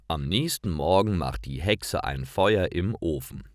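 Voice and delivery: ordinary delivery, nothing marked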